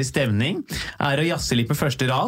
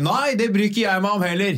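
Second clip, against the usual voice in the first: raised heckler shout from the crowd, mock; heckler calling out; 6 semitones higher than usual. Heckler in the crowd interrupting the comedian, mock yell. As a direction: call it out from the seats, half-hearted. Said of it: "en fra salen som ropte".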